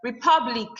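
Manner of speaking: "Republic" with PUB stressed. Shown as a demonstration. Teacher showing second to last syllable stress, "Republic" punched on PUB. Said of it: "The pub is the second to the last syllable".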